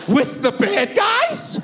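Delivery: slow, high-pitched squeal of disbelief; mock-horrified child's question; horrified young son. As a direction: imitate a scared kid, stretching each word. Scared son asked to pose.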